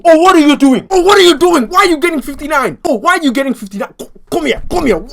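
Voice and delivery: high, loud repeated questioning; outraged scolding. Retold or imitated. Student to friend who got fifty-nine, outraged scolding. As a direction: yell it in high-pitched disbelief, easing slightly.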